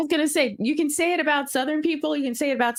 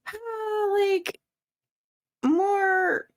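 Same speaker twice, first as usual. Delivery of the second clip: a stretched Southern drawl on the one word "more"; drawl demo; 9 semitones higher, much slower than usual. Shown as a demonstration. Speaker tries to show what a drawl sounds like, Southern drawl on "more". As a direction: draw out "more" slowly, the way a Southern drawl would. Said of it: "when you think of a drawl".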